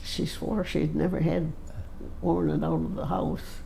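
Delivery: ordinary talk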